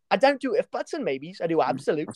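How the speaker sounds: hammed-up British football fan rant; angry Man United fan. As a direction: imitate a worked-up English fan laying down the law. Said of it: "this impression of this angry Man United fan".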